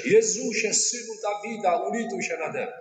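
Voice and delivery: high, pleading cry. Retold or imitated. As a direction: call out desperately to Jesus. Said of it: "zaczął się drzeć". (wysoki, błagalny krzyk)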